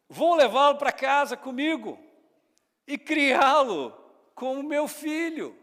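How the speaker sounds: proud princess declaration. Retold or imitated. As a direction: announce it like a princess claiming the baby. Princess declares adopting the baby. (anúncio alto e solene da princesa)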